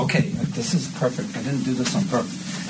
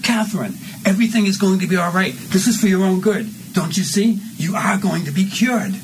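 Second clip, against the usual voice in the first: mean; 10 semitones higher, 5 dB louder than usual. Acted. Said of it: "you say this kind of meanly".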